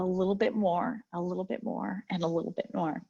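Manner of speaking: fading away. Acted. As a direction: let it trail off to almost nothing. Repeated phrase trailing away.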